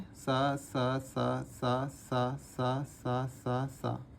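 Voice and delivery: soft repeated सा for the curve. Shown as a demonstration. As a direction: softly drill सा over and over. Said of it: "इसका कोई shortcut नहीं है".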